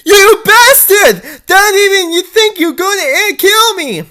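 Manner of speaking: outraged screaming at the villain; furious shout. Acted. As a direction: scream it at the killer. Dee Dee furious at killer.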